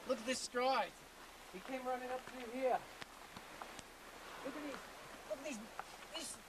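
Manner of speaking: hushed, excited tracker; wildlife watching whisper. Acted. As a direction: whisper as if the beast is near.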